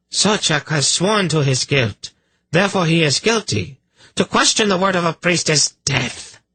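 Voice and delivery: high-pitched angry verdict. A narrator's reading. Priestess declaring the accused guilty, angry. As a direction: pronounce guilt with indignant force. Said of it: "Kabat said angrily".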